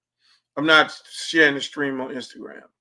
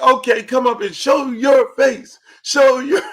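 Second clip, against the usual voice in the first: higher, louder, slow shouted demand; mock demand shout; 7 semitones higher, 7 dB louder, slower than usual. Telling TikTok viewers to show their face, shouted. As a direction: bark it out, slow and loud. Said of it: "we're like".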